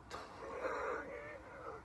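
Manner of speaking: high wheezing laugh; real laughter. Real, not acted. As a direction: laugh almost silently, wheezing. Amused at fish-stealing scene.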